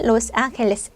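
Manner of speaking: 로스앤젤레스 as "los ángheles", h for g; Spanish pronunciation. Shown as a demonstration. Teacher repeating the Spanish name, 로스앤젤레스 as "los ángheles". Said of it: "스페인어로 발음하면".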